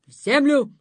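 loud commanding shout; shouted spell. A narrator's reading. Thorn-lady shouting a curse at Dunka. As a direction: hurl the curse like a blow. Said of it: "крикнула злючка".

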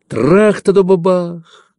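high, slow, ringing explosion noise; drawn-out artillery crash; imitated gun blast. A narrator's reading. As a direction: sound out a booming burst nearby. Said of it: "грохнул где-то ещё ближе".

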